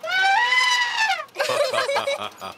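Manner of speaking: loud high hearty laugh; big laugh. Acted. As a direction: burst out laughing loudly. Guide laughs at the joke.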